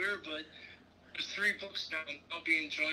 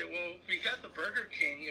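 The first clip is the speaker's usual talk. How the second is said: ordinary talk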